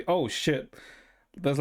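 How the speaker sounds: ordinary talk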